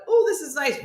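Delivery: high, light little coo; sing-song pleased, high-pitched; pleased pedalling. Retold or imitated. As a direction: lift it high, mock contentment. Acts out brief relief while pedalling.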